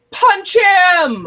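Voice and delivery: loud, high, drawn-out holler; yelled out. Retold or imitated. Viewer re-enacting yelling at characters in the car, loud high yell. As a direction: yell it at the screen with full lungs. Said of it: "and I yelled out".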